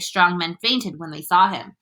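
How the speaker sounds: usual speaking voice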